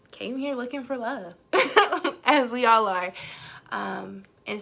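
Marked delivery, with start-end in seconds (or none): 0.1-3.1 chuckling through the words; laughing while talking. Real, not acted.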